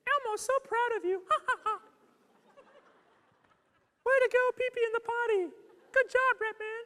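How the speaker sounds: very high, squeaky puppet falsetto with giggle; Elmo. Retold or imitated. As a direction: cheer on a child in a loud puppet squeak. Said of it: "And Elmo would say".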